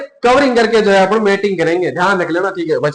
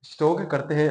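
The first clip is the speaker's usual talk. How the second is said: softer, sparing the throat; quiet, tired teacher's voice; dropped voice; 4 semitones lower, 8 dB quieter than usual. Real, not acted. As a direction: ease off the volume to save the voice. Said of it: "आवाज धीमी नहीं हुई है".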